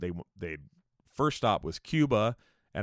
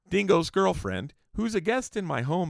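high, louder indignant complaint; still pissed; 10 semitones higher, 4 dB louder than usual. Real, not acted. Houseguest wronged the host in his own home, indignant. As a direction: voice raised in real grievance. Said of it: "I'm still pissed about it".

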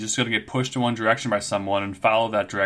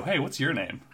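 higher-pitched casual passerby; role-played passerby; 4 semitones higher, 4 dB quieter, slower than usual. Teasing player as a passing character asking for a name, role-played passerby. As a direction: play a stranger strolling up to ask. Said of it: "R P like nine characters to walk by and go".

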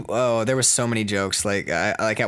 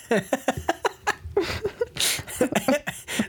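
long, high-pitched laughing fit; high giggling fit. Real, not acted. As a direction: crack up helplessly at your own joke. Cracks up at own teasing joke.

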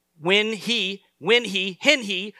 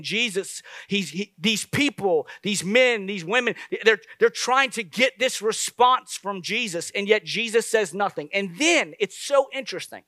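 usual speaking voice

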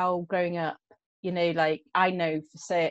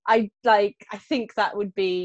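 ordinary talk

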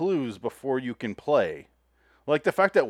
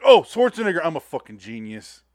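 smug bar quizzer; 7 dB louder than usual. Retold or imitated. Mocking bar trivia players, a loud smug blurt. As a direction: crow it like you're a genius for knowing. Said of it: "people are like".

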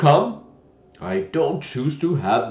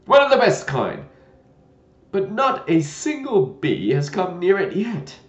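put-on loud, discontented old knight; grumbling old knight complaint; disgruntled muttering; 4 semitones higher, 8 dB louder than usual. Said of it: "in a discontented tone".

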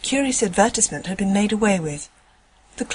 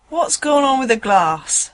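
slower, lightly voiced working driver; gruff tram driver; 3 semitones higher, 3 dB louder, slower than usual. A narrator's reading. Driver asks the conductor about the glass, slow and puzzled. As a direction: play the puzzled driver drawing near. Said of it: "said the driver, approaching".

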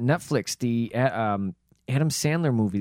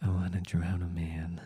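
low, soft whispered wish; creepy whisper; 6 semitones lower, 10 dB quieter than usual. Retold or imitated. Co-host voicing the creepy boy's wish in a whisper. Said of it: "his first wish is this".

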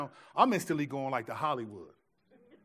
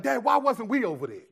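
higher and louder mock-indignant whine; mad complaint; 7 semitones higher, 6 dB louder than usual. Son complaining to his dad about missing it, mock indignant. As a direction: play the sulking kid who missed out. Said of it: "First, first I was mad".

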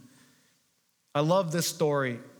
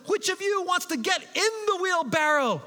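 showman's challenge; 13 semitones higher than usual. Retold or imitated. Blondin daring the cheering crowd, high showman's call.

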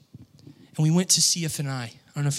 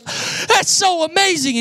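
much higher and louder, gushing; excited friend; 7 dB louder, slower than usual. Retold or imitated. Friend reacting to the prophecy, gushing excitedly. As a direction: gush like he can't contain himself. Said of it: "he's just like".

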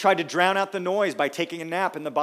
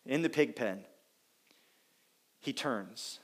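normal talking voice

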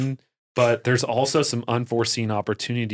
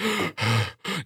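mock panting from effort; winded gasps. Speaker acting out the end of folding up the bed, winded. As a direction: huff and puff, exaggerated.

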